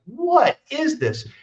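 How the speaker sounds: incredulous, drawn out; baffled. Retold or imitated. Narrator reliving his disbelief at the channeling.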